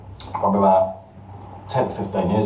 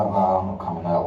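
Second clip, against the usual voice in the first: low, soft and sorry; 5 semitones lower, 5 dB quieter than usual. Retold or imitated. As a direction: mumble it like a disappointed kid. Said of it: "you'd come back all sorry".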